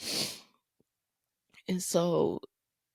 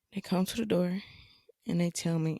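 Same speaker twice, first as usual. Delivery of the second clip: normal talking voice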